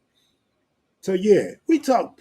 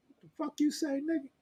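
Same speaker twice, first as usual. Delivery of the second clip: higher, softer, a put-on voice for the challenge; mock tough guy challenge; 9 semitones higher, 9 dB quieter, faster than usual. Acting out a street challenge, softer and higher. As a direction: play a guy squaring up, under the breath. Mock confrontation after demo.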